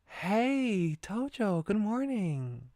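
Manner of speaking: high, bright schoolgirl greeting; girl character voice. Acted. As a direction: sing out a cheery hello to a classmate. Voicing cheerful girl character.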